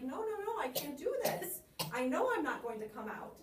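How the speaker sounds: high, anxious little fly voice backing away; the fly saying no to the spider, pitched high; fly refusing, high. Shown as a demonstration. Teacher voicing the fly refusing the spider, high and worried. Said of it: "the spider at first is like".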